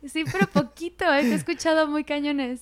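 giggling through the words, teasing; laughing tease. Real, not acted. Host teasing guest about his northern accent, laughing. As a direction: laugh it out while ribbing him playfully.

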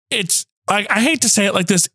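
ordinary talk

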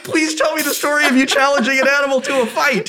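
high, delighted disbelief; can't believe it, begging for the story; amazed disbelief. Real, not acted. Husband begging wife for the raccoon story. Amazed reaction to her claim.

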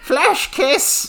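high, slow doting grandma; sweet granny voice. Acted. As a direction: coo it like a sweet old granny. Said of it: "Get outta here, Grandma".

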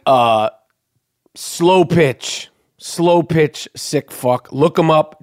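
usual speaking voice

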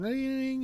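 put-on high sung ding like an old phone bell; imitated phone ring. Recalling the phone ringing, a high bell ding. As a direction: ring out like a loud rotary phone.

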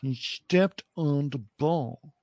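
put-on slow, drawn-out lockjaw accent; hammed-up Long Island lockjaw line. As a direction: quote it through stuck-together teeth, Long Island lockjaw style. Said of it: "they call it lockjaw because your teeth are stuck".

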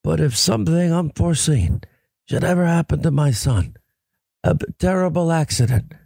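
strained, halting Godfather impression; solemn mob boss warning, laboured and put-on; Marlon Brando. Host as the Godfather making peace with a warning, strained movie impression. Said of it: "you like my Marlon Brando".

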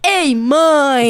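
put-on dubbed-movie child, protesting; Valentim. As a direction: mimic a little boy calling out to mom. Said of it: "o filho da Tilinha dublado".

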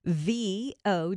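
each letter spelled out slowly. Real, not acted. Host spelling the texting word for listeners, slow and clear. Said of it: "text the word Devo".

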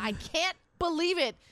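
high, slow mock awe; imitating a TV host fawning over his guest, drawn-out amazement; Stephen Colbert. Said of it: "Stephen Colbert, he looks at him".

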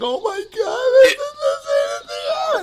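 high, wailing put-on bawl; exaggerated sobbing, voice shooting way up; mock hysterical crying. Dad retelling bawling while feeding his baby, mock hysterical crying. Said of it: "I'm just crying hysterically".

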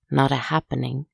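ordinary delivery

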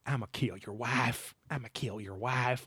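quiet, slow Southern drawl, ghostly and far off; Southern drawl EVP. Retold or imitated. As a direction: murmur it like a Southern ghost on tape. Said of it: "another Southern drawl E V P".

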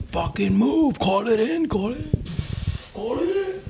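high, rising panicked yells; frantic shrill pleading, climbing in pitch; panicked gunner. Retold or imitated. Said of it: "his gunner is like".

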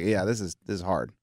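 low, soft, sinking admission; deflated. Retold or imitated. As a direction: imitate someone quietly giving up. Retells coach losing his confidence.

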